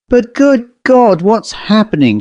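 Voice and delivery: a man watching the car vanish, aghast; horrified cry. A narrator's reading. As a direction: cry out in dread. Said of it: "cried Storley".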